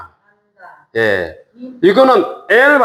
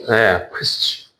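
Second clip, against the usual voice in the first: slow teacher drawl; 6 semitones lower, 5 dB quieter, much slower than usual. Shown as a demonstration. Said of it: "teacher slowly names letter".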